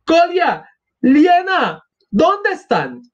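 put-on high exasperated cries for the children; acted frantic mother; alarmed calling. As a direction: call out high, scolding and searching.

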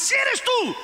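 very high, bold cry; sudden high-pitched acted challenge; Peter being brave. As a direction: call out fearlessly to the Lord. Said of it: "era un valiente".

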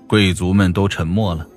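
plain reading voice

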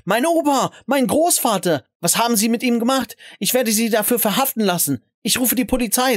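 high, outraged outcry; screaming woman. A narrator's reading. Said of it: "begann zu schreien".

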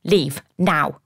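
curt, mildly angry command; snapped order. A narrator's reading. Man ordering his former fiancée out, snapping. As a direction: order her out with a touch of snap. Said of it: "he snapped at me".

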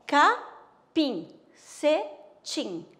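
capim stretched very slowly, then cetim; teacher spelling out capim slowly for the class; drawn-out demo. Shown as a demonstration. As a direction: draw out capim syllable by syllable. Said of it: "Vamos lá?".

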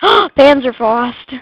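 loud, slow, drawn-out cry; gasp, then relish the weapon's name; excited exclamation. Real, not acted. Player gets a Panzerfaust, loud excited cry. Gasps at getting a new weapon.

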